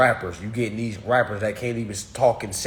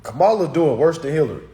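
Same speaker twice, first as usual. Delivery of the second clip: louder, punched-out declaration; emphatic repeat; 3 semitones higher, 7 dB louder than usual. Real, not acted. Streamer repeating his verdict on Kamala, emphatic and loud. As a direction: drive every word home loudly.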